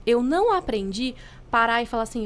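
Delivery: ordinary talk, nothing marked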